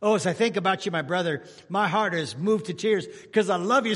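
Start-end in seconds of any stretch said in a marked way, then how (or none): none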